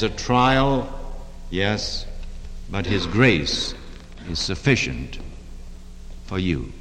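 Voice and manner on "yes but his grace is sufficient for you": tender reassurance. Real, not acted.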